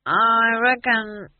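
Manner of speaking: put-on slow, high drunken drawl; drunk, concentrating. A narrator's reading. Drunk dwarf considering the question, slow and slurred. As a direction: drag it out, concentrating hard through the drink.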